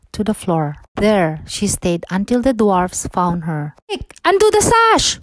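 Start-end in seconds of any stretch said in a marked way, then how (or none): 3.9-5.2 much higher, alarmed dwarf cry; urgent cry. A narrator's reading. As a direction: call out in panic to save her.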